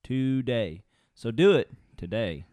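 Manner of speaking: "today" called out loud; "So do it today" pushed loud; emphatic today. Shown as a demonstration. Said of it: "English is hard sometimes".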